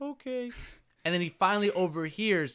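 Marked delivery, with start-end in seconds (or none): 0.0-0.5 small, soft, higher voice; meek giving in. Retold or imitated. Scolded hero giving in to his guildmates, meek. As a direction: imitate a mopey kid, very softly.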